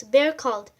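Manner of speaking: ordinary delivery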